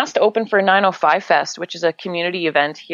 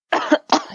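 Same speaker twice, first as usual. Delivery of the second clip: hard dry coughs; coughing fit. Real, not acted. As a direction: cough into the mic. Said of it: "sorry, I'm coughing so much".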